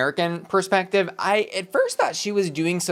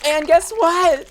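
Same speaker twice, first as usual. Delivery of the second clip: big hyped-up build, louder and much higher, slowing right down on the name; excited announcement; 10 semitones higher, 3 dB louder, slower than usual. Pretend. Reviewer hyping the next queen to viewers, excited announcer voice. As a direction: announce her like the headliner finally hitting the stage.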